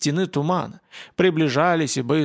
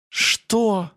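a lightly acted groaned question; high moan of exasperated disbelief; 7 semitones higher than usual. A narrator's reading. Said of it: "простонала она".

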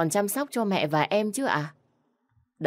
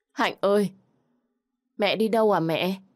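higher, louder tearful call of her name; crying out; 4 semitones higher, 4 dB louder than usual. A narrator's reading. Father weeping over his dying daughter, calling out higher and louder. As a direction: cry out to her, breaking down. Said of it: "bật khóc gọi con".

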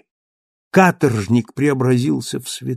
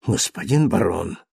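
lower, softer, fawning address; servile bow; 5 semitones lower, 3 dB quieter than usual. Acted. Thenardier bowing low to Marius, fawning and soft. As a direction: grovel as you bow low. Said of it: "кланяясь до земли".

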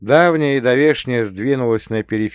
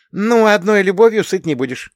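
lively, higher voice; perked up; 12 semitones higher, 7 dB louder than usual. A narrator's reading. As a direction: brighten up suddenly. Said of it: "оживилась сестрёнка".

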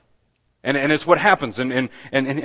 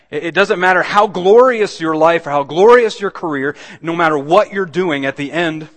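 loud, higher preacher's declamation; impassioned preaching; 5 semitones higher, 10 dB louder than usual. Real, not acted. Preacher warning the congregation that death comes regardless, impassioned preaching. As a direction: drive it home to the congregation.

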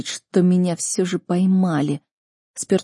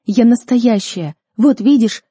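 loud, raised, emphatic; urgent assurance; 4 semitones higher, 7 dB louder than usual. A narrator's reading. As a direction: insist you are real, voice up. Desperately proving she is real. (громко, взволнованно убеждает)

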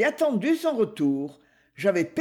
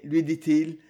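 plain reading voice

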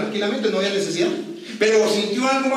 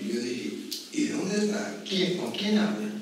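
much softer, bewildered questions; puzzled hush; 2 semitones lower, 10 dB quieter, slower than usual. Retold or imitated. Brother puzzled at sister talking alone, hushed. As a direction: ask softly, afraid she's lost it.

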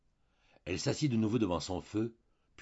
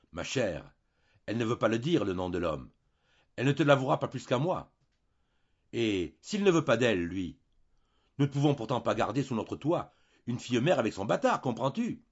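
higher and louder, pressing; exasperated husband; 5 semitones higher, 7 dB louder than usual. Acted. Husband lecturing his wife about the unwed mother, angry. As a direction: hammer the point home.

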